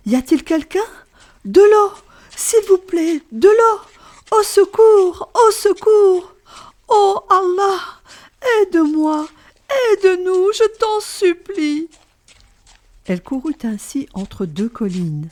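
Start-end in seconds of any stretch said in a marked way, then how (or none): 0.1-11.9 high, loud desperate pleading shout; shouting for water. Acted. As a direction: beg for water and help at full voice. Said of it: "Et elle criait".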